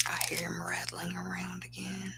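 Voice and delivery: hushed aside, barely voiced; whisper. Real, not acted. Speaker noticing him nearby, soft whisper. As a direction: whisper so he won't hear.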